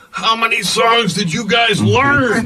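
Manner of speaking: high, slow, laughing mock heckler; heckler from the back. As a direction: call out from the back of the room. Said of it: "we hear from the back of the room".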